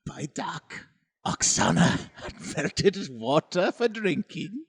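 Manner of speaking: put-on German accent. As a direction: narrate spookily in a mock German accent. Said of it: "I'm doing a German accent".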